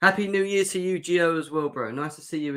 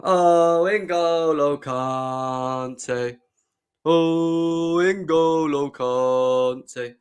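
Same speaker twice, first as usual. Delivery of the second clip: very slow, louder, long-stretched "Oh, N'Golo Kante"; drawn-out adoration; 5 dB louder, much slower than usual. Real, not acted. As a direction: savour the name like a beloved star. Savouring favourite player's name.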